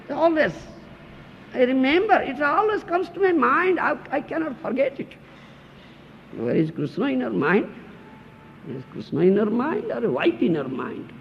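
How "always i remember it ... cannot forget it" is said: higher, dreamy voice of a smitten husband; mock infatuated husband. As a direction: play the man who can't stop thinking of her. Acts attached husband's thoughts.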